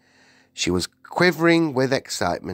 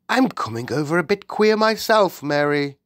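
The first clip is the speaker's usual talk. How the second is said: high royal voice; unsettled Queen; 8 semitones higher, 4 dB louder than usual. A narrator's reading. The Queen admits she feels queer too, unsettled. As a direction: admit it with dismay. Shaken Queen confides in maid.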